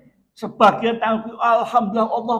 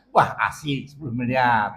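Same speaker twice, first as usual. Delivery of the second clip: lower, gloating mock delight; mock glee; 9 semitones lower than usual. Preacher mimicking a greedy man gloating over ten billion.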